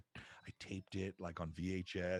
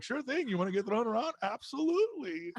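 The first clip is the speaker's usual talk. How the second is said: cheery reply; 13 semitones higher, 4 dB louder than usual. Retold or imitated. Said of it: "so they're like".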